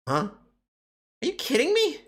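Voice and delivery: put-on squeaky hurried kappa girl; high girl voice. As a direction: brush them off in a high hurried voice. Same character, indignant.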